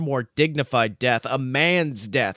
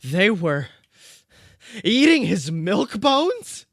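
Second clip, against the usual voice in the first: high dog voice; 10 semitones higher, 5 dB louder, slower than usual. Acted. Dog's voice in a story.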